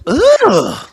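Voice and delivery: loud high disgusted "Ugh.". Retold or imitated. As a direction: gag at the salty bite. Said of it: "She took a bite and went".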